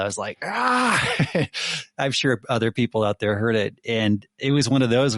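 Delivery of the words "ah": high-pitched yell of pain; mock "ah!" cry, pitched way up; retold pain yell. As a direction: re-enact yelping at a rolled ankle. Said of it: "where I just yelled".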